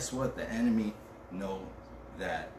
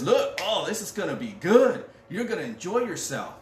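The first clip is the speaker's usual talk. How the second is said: loud, high-pitched enticing sales pitch; hyped-up tempter; 7 semitones higher, 7 dB louder than usual. Acted. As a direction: sell the temptation with big, gushing excitement. Acts out the enemy's enticing pitch.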